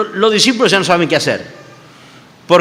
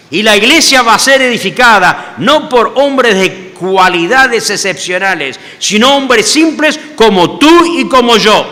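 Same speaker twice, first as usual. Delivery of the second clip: preaching proclamation; 5 semitones higher, 6 dB louder than usual. Retold or imitated. Jesus proclaims to Peter, preaching at full force. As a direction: proclaim it to the whole congregation. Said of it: "imagino que estaba así".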